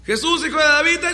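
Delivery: high pleading yell, cut off; shouting. Acted. Blind beggar calls to Jesus until shushed, shouting. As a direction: start a high cry and get interrupted. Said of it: "empezó a gritar más fuerte".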